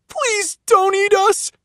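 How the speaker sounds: put-on high, pleading child begging for his life; frightened plea. Joey dangling from the monster, begging in fear. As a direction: beg the monster not to eat you. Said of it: "Joey cried".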